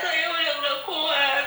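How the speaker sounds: slow, high, worn-out crying; real tearful surrender. Real, not acted. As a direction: weep it out slowly. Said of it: "Nggak perlu nangis".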